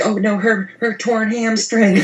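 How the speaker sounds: high, drawn-out fake concern; mock dismay. Co-host mimicking worried onlookers, mock dismay. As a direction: wail it in put-on worry.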